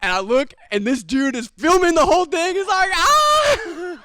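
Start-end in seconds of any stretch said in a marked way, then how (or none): 2.9-4.0 a very high, loud shocked "Ah!"; shocked scream. Retold or imitated. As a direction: yelp like you just saw a knockout. Filming guy screams in shock.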